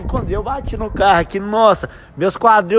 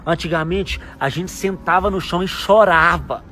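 softer, fast-paced confiding talk; nostalgic lecture; 6 semitones lower, 8 dB quieter than usual. Acted. Papa telling his daughter how simple things were, soft and quick. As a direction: lean in and share an old lesson.

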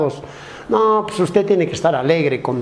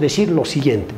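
ordinary delivery

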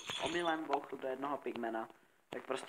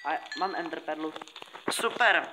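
excited; 5 semitones higher, 6 dB louder than usual. Real, not acted. Finds the killed Enderman.

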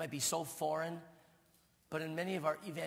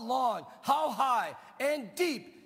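high, loud preaching cry; soaring preacher's proclamation; preaching shout; 10 semitones higher, 11 dB louder, much slower than usual. Real, not acted. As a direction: lift it up with full force. Preacher's climax.

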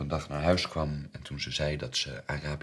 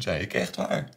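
ordinary talk

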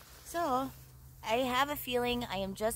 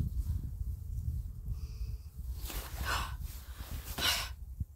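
long deflated breathy sigh; long heavy sigh; 5 dB quieter than usual. Real, not acted. Long heavy sigh after losing the biggest fish. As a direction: sigh out the disappointment.

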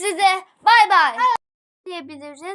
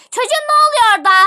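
loud, high, rapid scolding; acted furious teacher outburst; angry teacher shout; 6 semitones higher, 9 dB louder, much faster than usual. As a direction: yell across an unruly classroom. Teacher yells at unruly class.

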